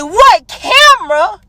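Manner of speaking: high, slow, drawn-out disbelief; mocking outraged repeat of what camera; incredulous echo. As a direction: throw his words back at him, stunned. Throws his denial back, stunned.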